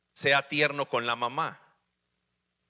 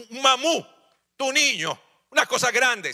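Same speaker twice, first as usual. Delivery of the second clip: put-on high and loud gushing over the boy; hammed-up admiring relative; mock gushing praise; 7 semitones higher, 5 dB louder than usual. Preacher imitating relatives gushing over a son, high and loud.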